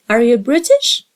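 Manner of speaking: ordinary talk, nothing marked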